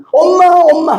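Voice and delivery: high mock wail of a hurt child; crying child imitation. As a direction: call out like a child running home in tears. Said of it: "아기가 막 울면서 집으로".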